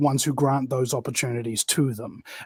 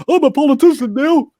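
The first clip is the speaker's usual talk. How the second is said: a higher, louder, self-important proclamation; pompous politician; 8 semitones higher, 6 dB louder than usual. Retold or imitated. Mocking new politicians entering Parliament, hammed-up pomposity. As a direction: puff up like a proud new politician.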